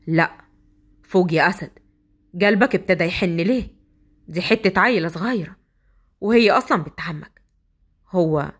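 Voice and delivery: low inner voice, puzzled; husband talking to himself. Acted. Asad arguing with himself about his softening heart.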